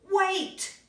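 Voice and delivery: sudden high, loud wait; call out wait. Acted. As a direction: stop everyone with a cry. Bear suddenly calls out.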